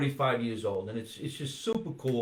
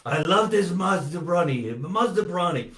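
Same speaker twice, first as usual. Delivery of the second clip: louder, higher imitated Persian-accented fan; 5 semitones higher, 5 dB louder than usual. Comedian imitating doctor's assistant gushing over Maz, Persian accent. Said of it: "with that accent".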